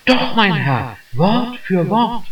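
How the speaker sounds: put-on the young clerk proudly insisting; mild eager young voice; eager insistence.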